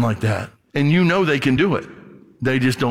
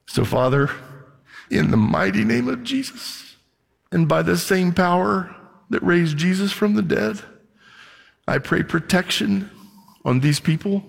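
higher-pitched, pausing prayer in short phrases; earnest prayer; 4 semitones higher than usual. Real, not acted. Pastor to God for these people, earnest intercession. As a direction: pray it out phrase by phrase, lifting the pitch. Leads a prayer over the congregation.

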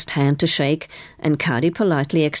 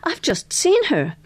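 high-pitched excited approval; high, pleased exclamation; exclaiming; 13 semitones higher, slower than usual. A narrator's reading. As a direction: exclaim it with a big lift in pitch. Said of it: "exclaimed Missus Polifax".

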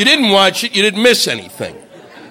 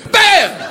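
high shouted mock gunshot; gunshot noise; 13 semitones higher than usual. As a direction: blurt a high bang like a gun going off. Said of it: "turned the rifles around and went".

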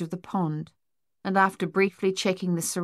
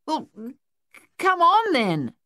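stammered start into a high yell; 10 semitones higher, 4 dB louder than usual. A narrator's reading. Luther rallying his mates to the pond, high loud yell. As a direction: stumble on the first sound, then yell. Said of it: "Luther yelled".

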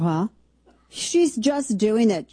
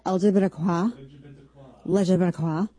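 mock spelled out. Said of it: "how did she say it?".

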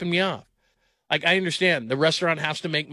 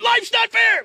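much higher, louder mock yell; mock shout; 6 dB louder, slower than usual. Dad snapping back at his son, loud and high. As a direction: fire it back instantly, hammed-up dad shout. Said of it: "and I went".

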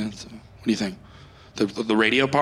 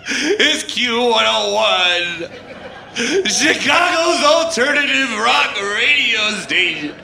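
slow DJ station ID, laughing through it; radio DJ intro; slower than usual. Acted. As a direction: announce the station while barely holding it together. Radio DJ on air.

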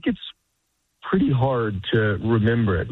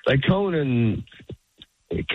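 usual speaking voice

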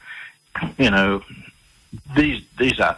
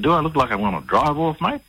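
retold indignant challenge, pitched higher; 9 semitones higher than usual. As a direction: push back, offended at the suspicion. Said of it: "as soon as he heard my tone of voice".

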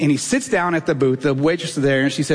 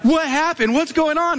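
high-pitched imitation of a worried wife; alarmed wife; 10 semitones higher than usual. Wife questioning her bawling husband, high alarmed voice. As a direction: press for answers in a raised, wifely voice.

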